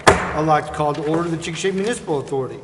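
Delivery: ordinary delivery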